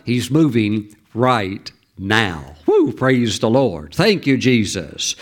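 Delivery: slow, low and fervent praise; slow worship praise. Real, not acted. Preacher praising God to the congregation, slow and fervent. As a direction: let it rise from the spirit, slowly.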